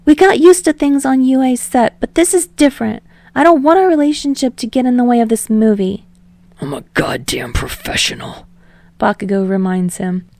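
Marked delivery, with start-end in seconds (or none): 0.0-6.0 higher, louder eager Deku voice; rambling. Acted. Deku rambling to Bakugo on the phone, higher and eager. Deku firm about the movie. 6.6-8.5 lower, softer growling Bakugo voice; snarled. Acted. Bakugo answering Deku's call, low snarl.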